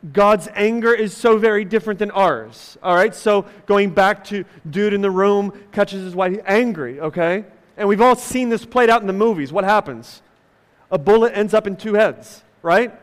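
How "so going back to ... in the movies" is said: ordinary talk, nothing marked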